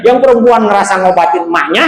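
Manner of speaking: usual speaking voice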